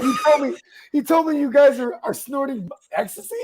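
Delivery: high-pitched retold accusation; mock accusation. Girlfriend calls about the snorting, high mock accusation. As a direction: push it up high, imitating an accuser. Girlfriend confronts him by phone.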